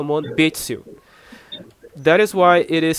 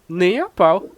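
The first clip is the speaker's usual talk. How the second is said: indignant refusal, pitched up; offended intonation; 4 semitones higher, 4 dB louder than usual. Shown as a demonstration. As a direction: turn down the loan as if offended. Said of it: "offensive intonation".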